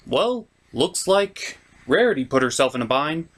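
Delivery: ordinary talk, nothing marked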